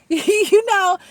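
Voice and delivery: loud high laugh into the words; cracking up at her own rant. Real, not acted.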